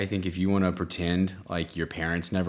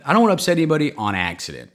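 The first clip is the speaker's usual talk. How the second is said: louder, faster insistent restatement; emphatic repeat; 5 semitones higher, 6 dB louder, faster than usual. Real, not acted. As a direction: push the point harder the second time.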